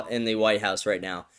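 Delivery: normal talking voice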